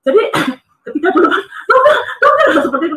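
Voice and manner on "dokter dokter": high-pitched panicked call for the doctor; re-enacted cry for help, pitched way up; retold urgent call.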